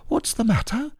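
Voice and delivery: high, small animal voice; a little worried squeak; Piglet voice. A narrator's reading. Piglet asking the puzzled Pooh, high and small. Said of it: "asked Piglet".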